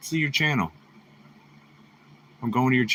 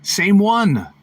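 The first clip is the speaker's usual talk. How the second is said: high, loud surprised exclamation; 7 semitones higher, 7 dB louder than usual. Real, not acted. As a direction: blurt it out in disbelief. Surprised by matching drawing.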